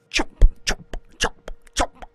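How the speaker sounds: loud breathy chomp noises; zombie chomping. A narrator's reading. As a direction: snap the chomps like gnashing teeth. Zombie teeth chomping, imitated.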